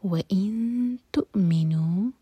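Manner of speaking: hide the نون of وَإِن in the nose; hidden nun. Shown as a demonstration. Teacher recites slowly to model ikhfa. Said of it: "cut off sound آئے گا".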